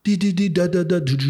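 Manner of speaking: rhythmic "di-di-di, da-da-da" noise, stepping downward; imitated trip pulse. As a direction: mimic a pulsing machine. Imitates the trip's pulsing sound.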